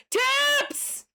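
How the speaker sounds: high, bright shout of Tips!; sudden hyped segment call; excited announcement. Acted. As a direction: announce it like a game-show bumper.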